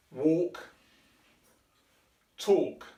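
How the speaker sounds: walk said from the chest with full voice; bare verbs. Shown as a demonstration. Teacher drilling the voiced bare verbs with breath. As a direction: voice talk with air from the chest. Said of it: "you need air from your chest to say these words".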